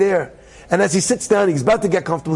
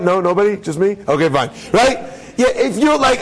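usual speaking voice

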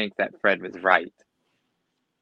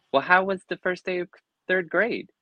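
lightly voiced mother, a touch higher; 8 semitones higher than usual. A narrator's reading. Mother asking her son about school, lightly voiced. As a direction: ask brightly as a hint of a caring mother. Mother asks Ben cheerfully.

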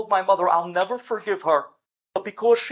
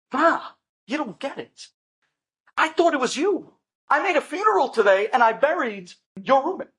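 higher, pushed, exasperated; panicked son correcting his mother; frantic explaining; 6 semitones higher than usual. Acted. Son telling mother about the wrong funeral, frantic.

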